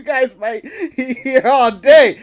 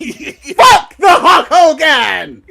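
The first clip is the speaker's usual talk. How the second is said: bellowed slowly through laughter; slow laughing shout; 3 semitones higher, 5 dB louder, much slower than usual. Acted. Reading a chat insult aloud, slow laughing shout.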